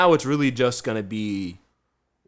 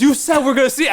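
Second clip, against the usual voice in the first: much higher, louder insistent coworker; imitating Kevin; 9 dB louder, faster than usual. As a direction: squeak it out like he's sure you promised. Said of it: "he goes".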